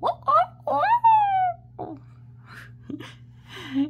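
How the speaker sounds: high, loud burst of laughter; real laugh. Real, not acted. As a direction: laugh at your own crow. Laughs after crowing.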